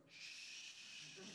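long, soft shush. Retold or imitated. Dad to his kid during a phone call, shushing. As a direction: imitate dad's drawn-out warning shush. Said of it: "looks over and he goes".

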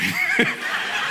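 high burst of laughter; a laugh breaking out mid-story. Real, not acted. Laughing at own punchline.

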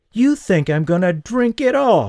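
high, loud furious snap; a woman insulted, snapping high and loud. A narrator's reading. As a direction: lash out at him, stung. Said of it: "she snapped".

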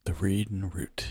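breathy whisper of the tavern name. Real, not acted. Player whispering the tavern name back to the table, savoring it.

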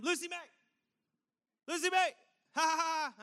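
high, loud worried call with a nervous laugh; half-name call. Retold or imitated. Dad calling his missing daughter, loud and high. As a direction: call out the full name, fighting to stay cheerful. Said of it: "like, half name".

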